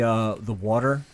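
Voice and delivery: ordinary delivery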